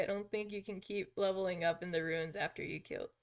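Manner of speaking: usual speaking voice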